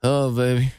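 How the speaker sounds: low mumbled come-on; mumbling. Pretend. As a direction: mumble it low, barely articulated. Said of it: "Mumble it".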